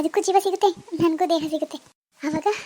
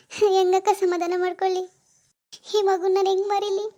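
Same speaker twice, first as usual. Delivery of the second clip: crying. Acted. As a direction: plead through tears until you collapse into sobs. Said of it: "ಅಳ್ಬೇಡ, ಅಳ್ಬೇಡ".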